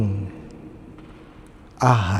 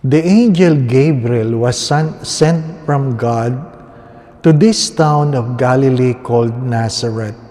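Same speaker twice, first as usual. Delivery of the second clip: Gospel proclamation; 6 dB louder than usual. Acted. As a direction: proclaim it like a priest at Mass.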